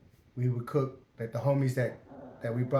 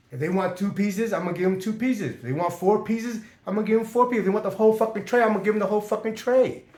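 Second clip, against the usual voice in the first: rising, louder, faster defiance at the guard; defiant outburst; 8 semitones higher, 6 dB louder, faster than usual. Retold or imitated. Prisoner yelling at the guard, heated defiance. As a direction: tell the guard off, building hot.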